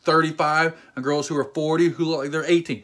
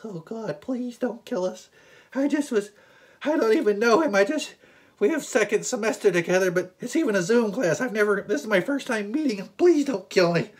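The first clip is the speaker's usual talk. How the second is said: higher, pleading frightened voice; scared friend; 7 semitones higher than usual. Retold or imitated. Nervous friend pleading with the tough guy, frightened higher voice. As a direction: beg nervously not to be hurt.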